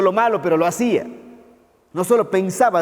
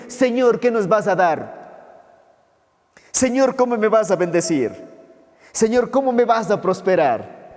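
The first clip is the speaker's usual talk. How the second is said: higher-pitched mock plea for blessings; self-seeking prayer, imitated; 4 semitones higher than usual. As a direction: imitate believers asking God what they will get. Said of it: "haciendo la pregunta incorrecta". (imitando una súplica interesada, en tono agudo)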